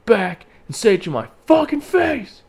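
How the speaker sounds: loud, high-pitched challenge; retold angry shout. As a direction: re-enact yelling after a speeding car. Re-enacts shout after the car.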